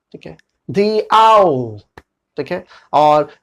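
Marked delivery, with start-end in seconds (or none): 0.7-1.8 the said as thee before owl, drawn out; "thee" before vowel, slow. Shown as a demonstration. Teacher modelling the thee sound, slowly. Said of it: "तब sound आएगा दी".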